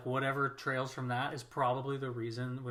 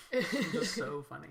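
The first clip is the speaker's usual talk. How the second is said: trying not to laugh; 3 semitones higher, slower than usual. Real, not acted. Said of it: "stop making me laugh".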